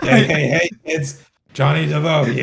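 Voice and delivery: loud phlegmy gurgle; throat gurgle. Retold or imitated. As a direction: push the gurgle for laughs. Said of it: "you're emphasizing your, like, uh, your phlegmy voice".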